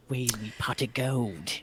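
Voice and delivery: lightly voiced leprechaun, slow; a hint of a creepy leprechaun. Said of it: "starred a legendary actor".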